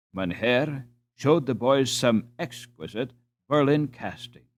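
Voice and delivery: plain reading voice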